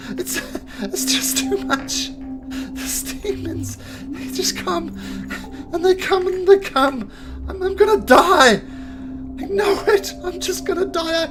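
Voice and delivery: high, loud, breaking crying wail; sobbing drow. Acted. The drow breaks down before his watching comrades, sobbing. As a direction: fall apart in panicked tears. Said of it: "he's just sobbing".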